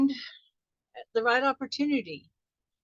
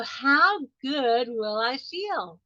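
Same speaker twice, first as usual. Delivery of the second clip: slow savoring question; much slower than usual. Shown as a demonstration. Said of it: "the clincher".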